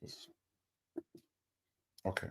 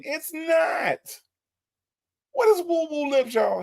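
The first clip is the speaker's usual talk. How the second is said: exasperated; 12 semitones higher than usual. Real, not acted. Host exasperated at the phrase.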